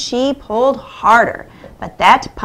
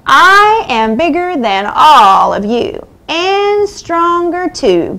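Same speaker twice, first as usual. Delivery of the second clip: boastful; 6 semitones higher, 4 dB louder than usual. A narrator's reading. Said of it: "boasted the mummy".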